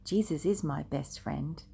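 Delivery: usual speaking voice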